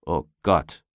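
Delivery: low, mildly exasperated groan. A narrator's reading. Policeman at the wheel reacts to the tearful prisoner, groaning. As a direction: groan it under your breath, pitch dropped. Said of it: "stöhnte der Polizist".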